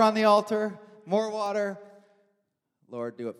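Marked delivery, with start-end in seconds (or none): 2.9-3.4 quiet prayer. Retold or imitated. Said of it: "Elijah praying at the altar".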